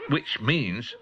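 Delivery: plain reading voice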